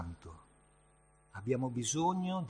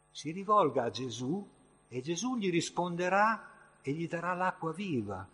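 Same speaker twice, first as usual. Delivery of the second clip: ordinary delivery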